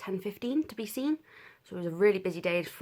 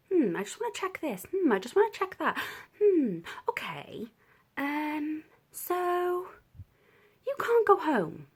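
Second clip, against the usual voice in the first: higher, hesitant checking doctor; 10 semitones higher, 4 dB louder than usual. Retold or imitated. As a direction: play the puzzled doctor, pitched up. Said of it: "she was like".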